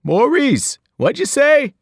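confused distant shout; shouting from outside. A narrator's reading. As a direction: yell from outside the trailer. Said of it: "shouted back".

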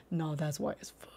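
voiceless whisper; conspiratorial whispered roast. Real, not acted. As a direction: hiss the joke out.

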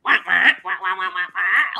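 loud, quick run of imitated frog quacks; playful mock frog calls answering each other; bullfrog chorus. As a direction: croak back like a whole lake of bullfrogs. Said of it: "and then you would hear".

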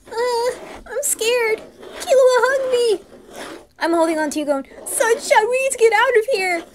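high frightened cry, then rushed words; terrified whimper. Acted. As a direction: panic and cling to your friend. Board moves on its own.

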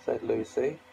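ordinary delivery

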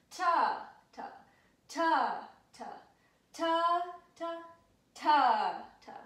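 chanted "ta" with loud accents; accented tas. Shown as a demonstration. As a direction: hit the accented "ta" hard and loud. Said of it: "say them a little bit louder or emphasize them".

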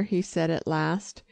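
usual speaking voice

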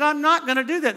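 refusing the marketing people loudly; emphatic refusal. Retold or imitated. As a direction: stress NOT, firm and loud. Retelling his refusal, heated.

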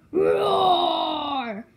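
slow, loud roaring monster voice; scary roar. Pretend. Plush finally finds its scary roar, loud and slow. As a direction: stretch it into a big roar. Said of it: "Yeah, that's scary".

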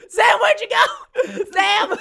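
shouting a friend's name, laughing; calling out. Real, not acted. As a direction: call out across the room.

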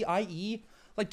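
usual speaking voice

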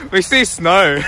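delighted shout about snow; excited. Real, not acted.

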